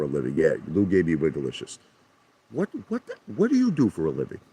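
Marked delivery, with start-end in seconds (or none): 2.5-4.4 sudden high-pitched disbelief, quick; what what, thrown off, much higher; incredulous what. Real, not acted. Baffled by Dale's claim.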